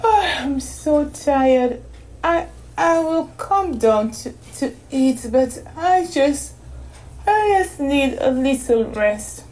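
very slow, drowsy and high; barely awake, dragging each word; sleepy girl voice. A narrator's reading. Exhausted girl voice.

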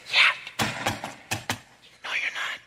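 whispered "Yeah, no, you're not"; whispered reply. Retold or imitated. Holy Spirit answering the boastful believer, whispered. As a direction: breathe it as a dry whispered answer. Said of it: "And the Holy Spirit saying".

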